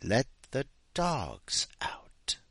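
hushed, word-by-word menace; slow question. Acted. Bow-tie man to the remaining three, slow hushed question. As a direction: space out each word in near-whisper. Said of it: "he asked slowly".

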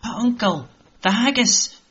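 put-on eager boy. Jamie telling his uncle about the haggis, eager boy voice. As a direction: pipe up like an excited boy.

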